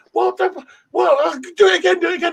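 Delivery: high, excited imitation; high-pitched mock excitement; imitated delight. Imitating delighted American woman.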